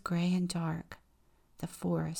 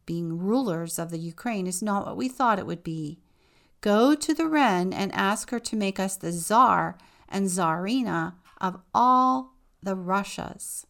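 demanding; 8 dB louder than usual. Acted. Parents demand to be tsars.

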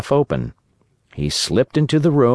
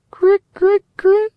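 very high, faint cricket chirp; weak dying chirp; 4 dB louder than usual. Acted. Dying cricket's last feeble cry, very high chirp. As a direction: squeak out a last tiny chirp. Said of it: "With a last weak".

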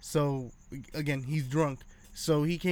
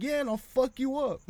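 much higher, cocky threat; laughing off a fight he wants; mock cocky threat; 8 semitones higher than usual. DJ answering the uncle's taunt: high, cocky mock threat.

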